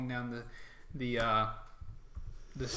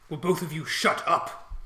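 louder, higher scolding mage; irritated orc mage; 4 semitones higher, 6 dB louder than usual. Acted. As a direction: silence two underlings as an annoyed mage. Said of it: "the orc mage says".

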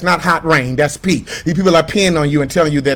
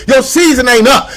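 preaching shout; 3 semitones higher, 3 dB louder than usual. Real, not acted. Preaching at full force.

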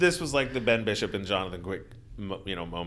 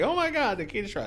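excited; 11 semitones higher, faster than usual. Retold or imitated. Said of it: "a text from Carlin Payne saying".

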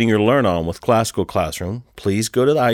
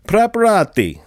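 hammed-up Italian pronunciation, pitched high; over-the-top Italian accent, louder; rough Italian; 10 semitones higher, 4 dB louder than usual. Shown as a demonstration. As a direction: roll it out like a mock Italian. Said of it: "which is rough Italian for brace yourselves".

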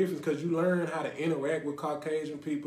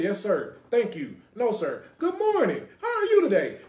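prim, proper job-interview voice, going higher and laughing at the end; mock polite office talk; 5 semitones higher, 6 dB louder than usual. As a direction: ham up the polite greetings of a job applicant, breaking into laughs. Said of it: "you have to say".

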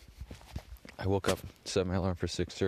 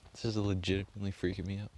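usual speaking voice

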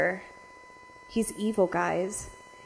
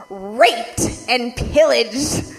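smug; 2 semitones higher, 4 dB louder, much slower than usual. Retold or imitated. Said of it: "With a smile on his face".

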